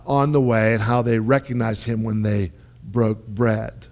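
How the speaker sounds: ordinary delivery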